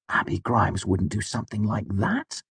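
mildly taken aback; shocked. A narrator's reading. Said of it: "Bast looked shocked".

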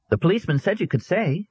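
put-on high, curious boy; boy asking the grieving girl; young boy voice. A narrator's reading.

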